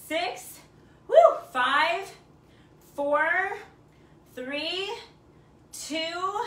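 loud, high, whooping countdown; rally them through the last reps; excited cheering. Real, not acted.

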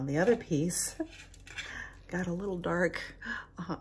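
usual speaking voice

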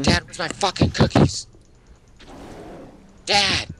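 shouting for dad from afar; yelling across the room. Pretend. Calling to a parent elsewhere.

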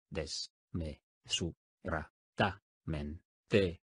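"Des. Me. Su. Ra. Ta. Men. Te." each syllable spoken apart with a pause. Shown as a demonstration. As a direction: split "Desmesuradamente" into separate syllables. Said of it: "dila silábicamente conmigo". (separando sílabas: "Des. Me. Su. Ra. Ta. Men. Te.")

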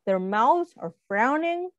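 plain reading voice

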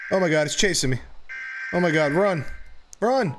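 frightened yelling while fleeing; panicked shouts, running for life; scared horror game streamer. Real, not acted. Chased by monster.

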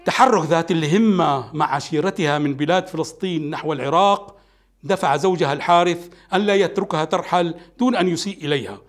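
usual speaking voice

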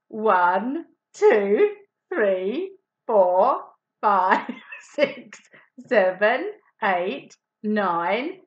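loud counting while patting the puppet's head. Real, not acted. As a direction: count out each pat.

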